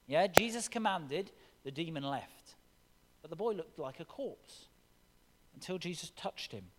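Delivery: usual speaking voice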